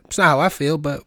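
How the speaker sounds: normal talking voice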